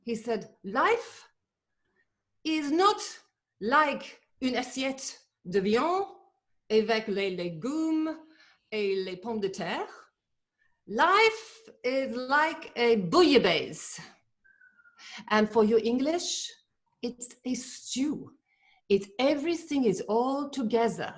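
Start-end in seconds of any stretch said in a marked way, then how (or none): 0.6-14.0 slow, loud mock French accent. Speaker quoting a man on stage, in mock French accent. 15.3-21.1 lightly kept mock French accent. Speaker explaining the quote, still in a light mock French accent.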